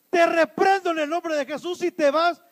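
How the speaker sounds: loud, high commanding rebuke; with authority. Acted. Shop victim rebuking an armed robber, loud and authoritative. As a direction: order him out forcefully. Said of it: "Se levanta con autoridad y dice".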